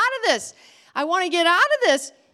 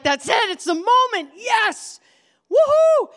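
rising, shouting joy; excited cheer; 7 semitones higher, 4 dB louder than usual. Acted. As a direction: whoop it up like a kid. Elijah cheering, God says it's time.

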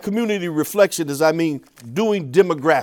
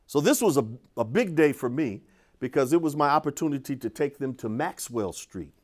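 usual speaking voice

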